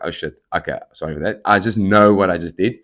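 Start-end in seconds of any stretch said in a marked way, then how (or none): 0.0-1.4 sheepish mutter. Retold or imitated. Embarrassed apology after wink tic.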